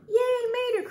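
very high, loud fan squeal; excited cheer. A narrator's reading. Fan cheers the rescue hero, crying out high and loud. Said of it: "cried Mia".

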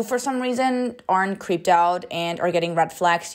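ordinary talk, nothing marked

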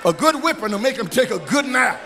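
preaching build. Acted. Pastor telling the church about discipline, preaching. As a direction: build the point to the crowd.

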